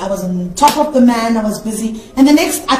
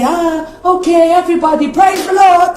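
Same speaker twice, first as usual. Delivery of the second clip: tour guide greeting; 5 semitones higher than usual. Retold or imitated. Guide greeting visitors on the prayer mountain, mildly hammed-up. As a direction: call the crowd like a church guide. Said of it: "That's what we say".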